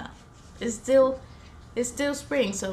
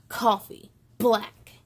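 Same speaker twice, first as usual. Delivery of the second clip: lively picture-book read-aloud with voiced characters; reading to children; 3 semitones higher than usual. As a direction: bring each rainy-day page to life for little listeners.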